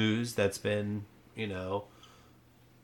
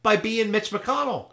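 loud, high-pitched indignation; indignant outrage; 10 semitones higher, 9 dB louder than usual. Acted. Co-host defends his senator from the left, indignant. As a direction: draw it out in hammed-up outrage.

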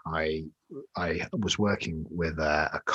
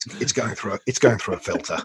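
hammed-up radio voice; puffed cheeks mocking; 4 semitones higher, 6 dB louder than usual. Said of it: "he's got quite a good voice for a podcast".

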